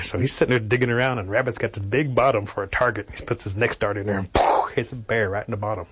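ordinary delivery, nothing marked

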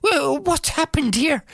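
high, startled exclamation; startled old woman. Acted. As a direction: gasp it out in surprise. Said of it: "And she thought".

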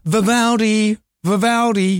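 stretched-out call without real shouting; mock calling out. Shown as a demonstration. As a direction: demonstrate calling a dog's name across a park, slowly. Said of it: "a good name that you could yell out".

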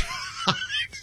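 airy high giggle; short breathless laugh; breathy giggle. Real, not acted. Laugh after own joke.

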